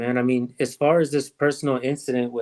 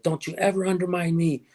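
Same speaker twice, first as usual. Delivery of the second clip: mildly aggressive mock warning, pitched higher; imitated aggression; 5 semitones higher than usual. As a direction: quote him with a touch of menace, voice raised in pitch only. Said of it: "you don't have to be that aggressive".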